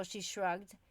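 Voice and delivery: ordinary delivery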